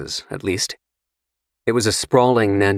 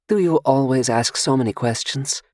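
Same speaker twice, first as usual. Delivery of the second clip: teasing, pitched up in a light feminine voice; mock pout at his questions; playfully disappointed; 6 semitones higher than usual. Seductive stranger teases Carter about his questions, playfully disappointed. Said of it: "playfully disappointed".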